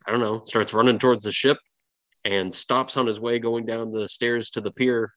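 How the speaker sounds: ordinary talk, nothing marked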